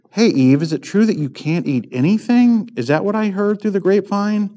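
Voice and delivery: lightly mocking, gossipy question rising high at the end; imitated serpent. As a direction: play a nosy serpent, voice climbing. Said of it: "it sounds like he's saying".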